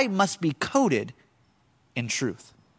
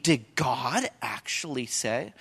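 slow, lower, softer snake question; hushed serpent echo; 5 semitones lower, 7 dB quieter, slower than usual. Acted. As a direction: murmur the doubt slowly like the serpent. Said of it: "The first lie comes in the form of a question".

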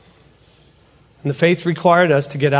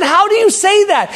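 high-pitched retort in disbelief; incredulous challenge; 4 dB louder than usual. Retold or imitated. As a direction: question him with voice pitched high. Speaker challenges pastor again.

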